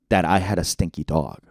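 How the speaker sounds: ordinary delivery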